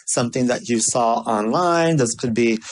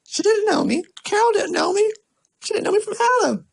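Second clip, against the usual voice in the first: high, rising, faster insistence; high excited repeat; excited emphasis; faster than usual. Real, not acted. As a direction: build up high and fast.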